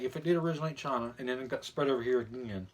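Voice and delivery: normal talking voice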